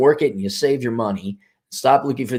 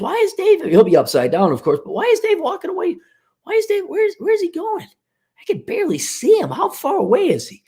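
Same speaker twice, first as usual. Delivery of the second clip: much higher, puzzled client peering upside down; bewildered high voice. Pretend. Confused client, upside down, wondering where Dave went. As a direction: sound lost and baffled, voice up high.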